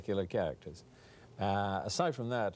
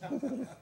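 laugh. Real, not acted. Laughing inside the Russian ad.

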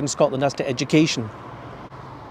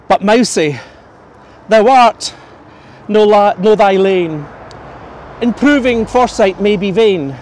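declaimed Scots verse, louder and slowed on the famous line; poem recital; 3 dB louder, slower than usual. Acted. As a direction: give the verse weight, let the schemes line drag out.

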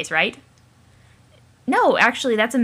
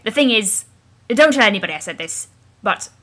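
normal talking voice

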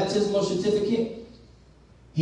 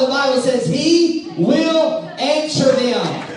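forceful, driving preacher delivery; preaching; 8 semitones higher, 6 dB louder, slower than usual. Real, not acted. Preacher telling congregation God will answer them, preaching. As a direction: preach it out to the whole room.

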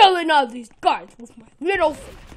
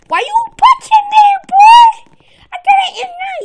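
high, loud indignant yell; outraged shout; 14 semitones higher, 10 dB louder than usual. Real, not acted. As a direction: yell at the puncher, offended. Being punched in game.

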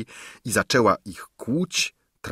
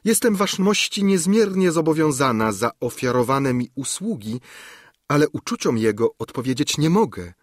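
higher-pitched, formal princess voice; courtly refusal in a raised voice; princess declining a suitor; 5 semitones higher than usual. Acted. Princess politely turning down the count's proposal, high formal voice.

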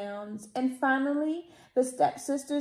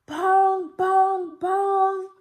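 higher, loud sung bongs; clock bongs; 7 semitones higher, 7 dB louder, much slower than usual. A narrator's reading. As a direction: ring out like a chiming clock. Clock strikes midnight.

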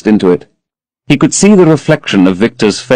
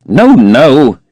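sharp, impatient no, louder; petulant; 4 semitones higher, 4 dB louder than usual. A narrator's reading. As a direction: brush her off like a spoiled young man. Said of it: "he cried petulantly".

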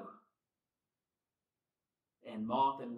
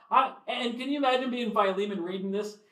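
laughing; 7 semitones higher, 7 dB louder than usual. Real, not acted. Laughing at Paul's pressure.

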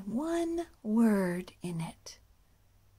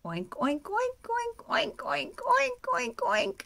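high rhythmic oinks; pigs oinking; 13 semitones higher, 5 dB louder than usual. Acted. Pigs crossing the field, high oinks. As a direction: oink off across the field.

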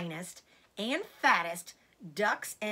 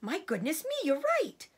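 surprised cry; 8 semitones higher, faster than usual. A narrator's reading. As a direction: exclaim as it suddenly dawns. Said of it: "cried Mister Fox".

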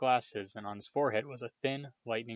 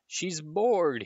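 explaining; 7 semitones higher, 4 dB louder than usual. A narrator's reading. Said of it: "Harry tried, yet again, to explain".

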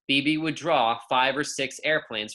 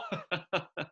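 short scoffing laugh; incredulous laugh; 5 semitones higher, 7 dB quieter than usual. Acted. Girl laughs at the yard teacher, scoffing. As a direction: laugh it off in disbelief. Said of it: "laughed B B".

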